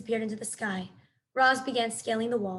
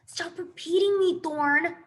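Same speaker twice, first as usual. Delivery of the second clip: irritated; 6 semitones higher, 4 dB louder, slower than usual. A narrator's reading. Sister bear scolds brother.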